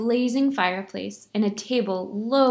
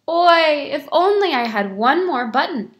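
loud, higher Yiddish-style oi; loud lament; 5 semitones higher, 7 dB louder than usual. Acted. Beggar hinting loudly to the silent shamas, hammed-up lament. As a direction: sigh it out so the shamas hears.

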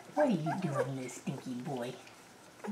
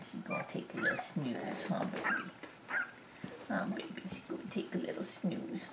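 hushed, soft lullaby whisper; 11 dB quieter than usual. Real, not acted. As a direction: whisper so the sleepy puppy can nap. Puppy falling asleep.

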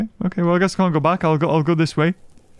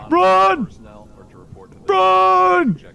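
urgent cry; 11 semitones higher than usual. Real, not acted. Gamer fleeing in the dark, high urgent cry. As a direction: urge himself to flee.

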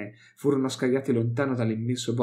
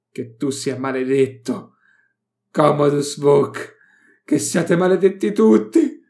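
slow, then much louder and higher; building curse; 4 semitones higher, 8 dB louder, slower than usual. Acted. Malait curses Vok, building to a loud cry. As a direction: curse them, rising to a scream.

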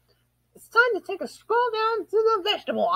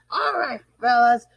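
ordinary talk

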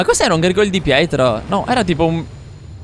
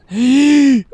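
high sharp gasp of fright; loud startled gasp; 10 semitones higher, 6 dB louder than usual. Real, not acted. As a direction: suck in air at the jump scare. Sudden enemy appears.

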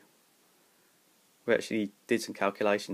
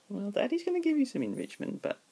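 put-on baby talk; 13 semitones higher than usual. Baby talk to his dog.

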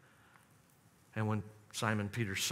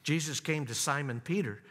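ordinary delivery

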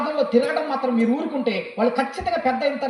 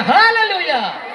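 slow, high, loud Hallelujah; drawn-out shout; 8 semitones higher, 10 dB louder, much slower than usual. Real, not acted. Preacher drawing the congregation into praise with a long shout. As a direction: stretch it out and lift the room.

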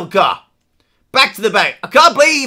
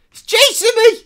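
panicked cry; 11 semitones higher than usual. Real, not acted. Chased by guard in game.

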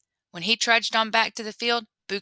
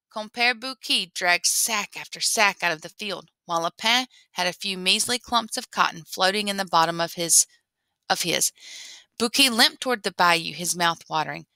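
ordinary delivery, nothing marked

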